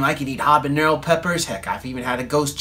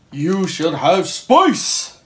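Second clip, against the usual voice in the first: slow, lightly voiced underworld god; slow, looming god; Hades voice; 3 semitones higher, 5 dB louder, much slower than usual. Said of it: "It's like Hades came like".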